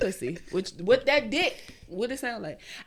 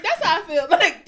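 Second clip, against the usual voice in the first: usual speaking voice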